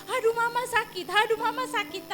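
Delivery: lightly voiced kids complaining of pain; a hint of a child's wail; imitating children's cry. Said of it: "udah teriak-teriak".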